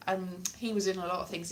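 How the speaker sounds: usual speaking voice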